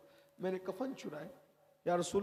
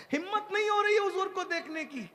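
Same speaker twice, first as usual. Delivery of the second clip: tearful plea; 11 semitones higher, 3 dB louder than usual. Acted. Youth's plea at the door.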